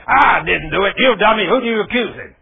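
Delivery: imagined angry retort. Retold or imitated. Preacher imagining the angry reply he didn't give, loud. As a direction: snap back in a pretend fight. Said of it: "He was expecting me to say".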